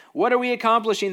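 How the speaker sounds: high, loud alarmed question; the priests panic; exasperated council. Acted. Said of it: "they asked".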